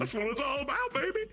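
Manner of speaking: high squeaky hype, far from his own; mock hype voice. Hyping up the friend, in a high squeaky voice.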